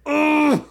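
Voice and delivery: loud high disgusted ugh; disgusted groan. Retold or imitated. Disgusted at cleaning bathroom.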